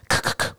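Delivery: "咳咳咳" as soft K puffs without voice; voiceless K. Shown as a demonstration. Teacher corrects learners' voicing, voiceless "咳咳咳". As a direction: click out "咳咳咳", vocal cords still. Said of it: "声带不要震动哦".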